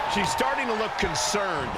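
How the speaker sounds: ordinary delivery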